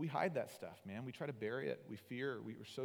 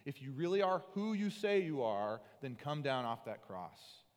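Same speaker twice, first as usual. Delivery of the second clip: louder taunting jeer; mocking shout; 3 semitones higher, 6 dB louder than usual. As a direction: jeer up at him from the crowd. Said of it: "people are screaming at him".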